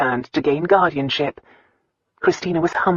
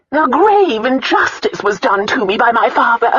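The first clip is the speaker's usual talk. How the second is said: high, loud, slowing self-pitying lament; 9 semitones higher, 5 dB louder than usual. A narrator's reading. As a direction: play the wronged aunt, dragging the ending. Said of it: "self-pity she heard in her aunt's voice".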